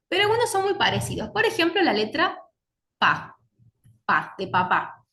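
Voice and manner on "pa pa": pa said slowly, lips pressed then released; sounding out pa for the class; p sound demo. Shown as a demonstration. Teacher demonstrating the sound pa. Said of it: "Digamos pa".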